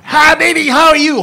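high, loud silly clown voice; Mad Mel voice. Retold or imitated. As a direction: squeal it like a wild goofball. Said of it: "Mad Mel".

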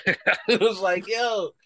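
high-pitched, breaking up with laughter; laughing. Real, not acted. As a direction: laugh through the words, pitch shooting up. Laughing over the retold scene.

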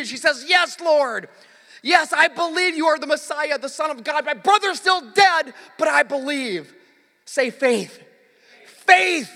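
loud, high-pitched preaching shout; impassioned preacher in full cry; full-force preaching. Acted. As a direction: proclaim it to rouse the whole congregation.

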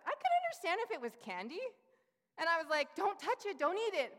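mother venting to her husband, high and loud; exasperated mom. Retold or imitated. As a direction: mom beside herself at a toddler. Mother exasperated about son and poo.

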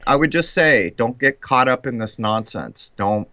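normal talking voice

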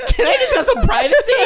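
put-on very high whining teenager; laughing whine for privacy; whiny teen.

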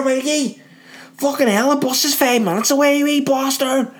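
put-on high, shrill-pitched shouting at her kid across the street; yelling Belfast mum. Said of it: "you'd hear a voice".

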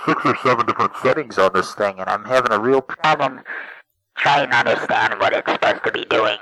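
low-pitched voice changer. Acted. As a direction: talk low through a voice changer. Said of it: "now it's really, really low-pitched".

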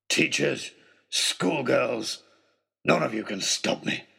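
put-on villain snarling at teacher and girl. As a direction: spit each word at them. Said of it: "he snarled".

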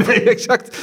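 high laughing agreement; amused laughing yes, exactly. Real, not acted. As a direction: burst out laughing as you agree. Laughing agreement after joke.

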